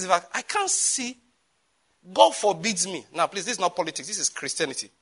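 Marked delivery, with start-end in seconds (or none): none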